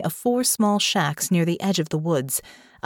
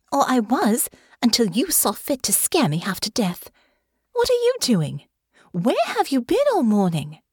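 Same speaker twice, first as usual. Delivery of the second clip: pitched-up, upset scolding; indignant scolding; 6 semitones higher than usual. A narrator's reading. Frightened lady scolds her friend, higher and indignant. Honor scolds after being scared.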